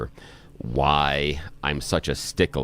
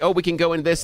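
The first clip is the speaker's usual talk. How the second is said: put-on eager kid voice; 12 semitones higher, faster than usual. Retold child pleading to play.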